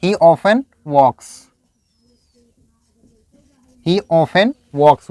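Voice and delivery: often said slowly, word by word, for learners; slow, deliberate teacher demo; slow dictation pace. Shown as a demonstration. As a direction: spell out each word slowly. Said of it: "slow dictation to the class".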